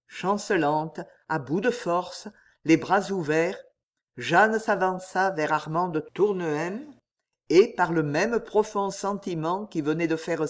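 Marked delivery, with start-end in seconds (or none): none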